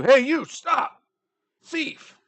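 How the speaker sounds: high, loud guard cry; guards yelling. Acted. Guards yelling at the fleeing orc, loud and high. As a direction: shout after the thief. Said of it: "the guards are yelling at you".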